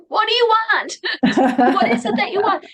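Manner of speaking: high, loud, hammed-up demand; mock movie yelling. Host imitating the yelling in The Notebook, playful shouting. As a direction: yell it theatrically, breaking into laughter. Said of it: "when they're yelling".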